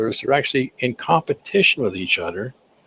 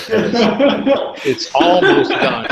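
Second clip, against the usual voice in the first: high, slow laughing line; chuckling it's almost done; 10 semitones higher, 4 dB louder, much slower than usual. Real, not acted. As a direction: laugh at your own joke. Joking about never-finished template.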